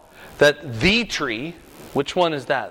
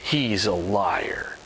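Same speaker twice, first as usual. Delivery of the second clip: low slow serpent; 8 semitones lower, 4 dB quieter, much slower than usual. Retold or imitated. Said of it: "And he says".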